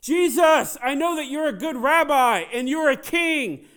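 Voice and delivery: high, sustained yell; full-throated shout. Acted. Blind man hailing Jesus as king, shouting at full force. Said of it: "this is what the blind man did".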